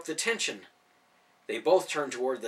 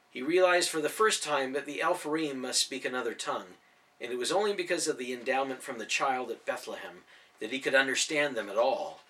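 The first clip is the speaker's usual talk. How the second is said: plain reading voice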